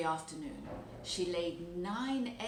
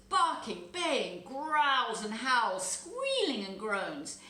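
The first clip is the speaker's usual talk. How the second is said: loud dramatic narration; 5 semitones higher, 7 dB louder, much slower than usual. A narrator's reading. Narrating awful dog fight noises.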